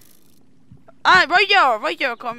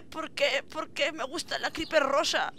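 soft heartbroken; 11 dB quieter than usual. Acted. Acted creeper romance scene.

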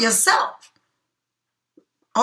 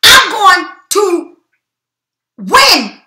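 louder, higher vow to win; determined outburst; 4 semitones higher, 5 dB louder, slower than usual. Retold or imitated. Reader voicing the listener's determined cry, loud and high. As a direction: spit it out like a promise to yourself. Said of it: "You said".

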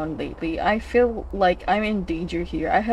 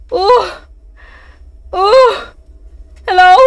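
nervous high calls; 10 semitones higher, 13 dB louder, much slower than usual. Real, not acted. Sees someone watching in game.

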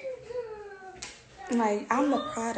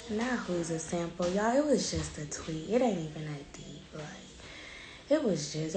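whispering on the phone; 8 dB quieter than usual. Real, not acted. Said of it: "Why are you whispering?".

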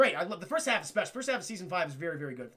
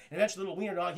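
ordinary delivery, nothing marked

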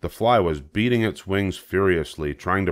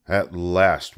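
ordinary delivery

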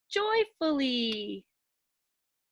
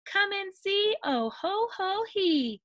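joyful high party hoots; gleeful animal cries; 8 semitones higher than usual. A narrator's reading. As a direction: squeal each call like playful animals. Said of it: "they all cried".

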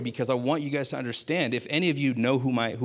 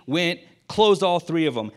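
ordinary talk, nothing marked

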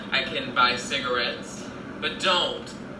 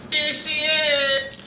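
much higher, louder and slow, like a showhost; hammed-up announcement; 13 semitones higher, 9 dB louder, much slower than usual. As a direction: present her like a diva on stage. Repeats the grand announcement, bigger.

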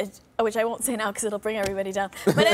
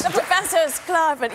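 sketch professor voice; 5 semitones higher, slower than usual. Retold or imitated. Comedian recalls her sketch character.

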